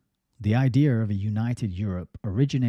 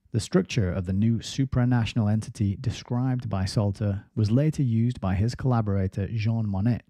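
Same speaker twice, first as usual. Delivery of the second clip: usual speaking voice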